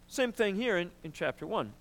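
normal talking voice

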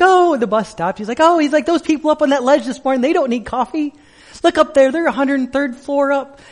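high, loud bus driver calling out; imitated tour guide. Tour guide to passengers on a double-decker bus, excited.

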